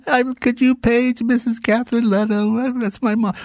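lightly voiced innocent boy; little boy voice. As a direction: play a cute lost kid at the counter. Kid asking manager to page mom.

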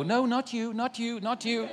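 high, comic turning-away voice; flustered angels. Acted. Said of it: "all the angels would go".

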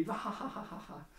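high staged laugh that tails off; mock cracking up. Joke teller acting the jail's response to the number, mock laughter. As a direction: fake the inmates' laughter, high then fading. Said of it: "everyone in the whole jail just starts cracking up".

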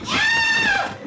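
sharp high scream of pain; short scream. Acted. Fleeing woman crashing into a fence, screaming. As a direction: cry out as you hit the fence.

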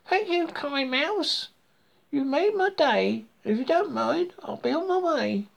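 high-pitched, thankful frog voice; cheerful frog, pitched well up; grateful frog. A narrator's reading.

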